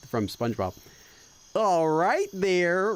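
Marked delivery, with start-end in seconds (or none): 1.6-2.9 very slow, stretched Texas drawl; bad fake Texas accent. Streamer trying out a fake Texas accent, drawn-out. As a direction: stretch out a fake Texan twang. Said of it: "gonna do the bad fake Texas accent".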